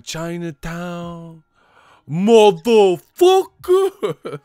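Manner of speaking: slow, stretched, ending in a huge high yell; drawn-out movie quote. Retold or imitated. Quoting the villain again, slow then a roaring yell. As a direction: stretch Chinatown, then blast motherfucker high and loud.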